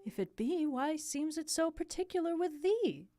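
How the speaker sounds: high, lilting royal question; consoling queen. Acted. Queen questioning her grieving son, high gentle voice. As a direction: press gently in a lifted voice.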